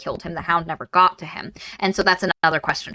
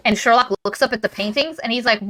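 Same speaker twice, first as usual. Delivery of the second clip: normal talking voice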